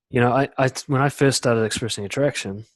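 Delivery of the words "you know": ordinary delivery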